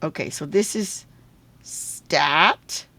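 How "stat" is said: STAT alone, drawn out, rising at the end; syllable sounded out. Shown as a demonstration. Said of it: "These are two syllables".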